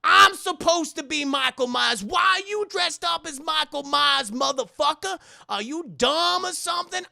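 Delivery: loud, high-pitched indignant berating; hammed-up angry telling-off; mock yelling. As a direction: chew him out in an exasperated yell. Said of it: "if they just yelled at him".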